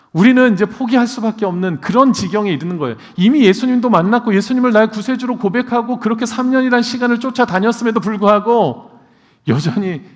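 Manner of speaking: loud, high pulpit cry; fiery sermon climax; impassioned preaching. Acted. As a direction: preach it with fervour. Preaching at full force.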